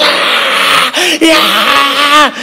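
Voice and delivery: high mock shouts; hammed-up crowd yell; crowd screams.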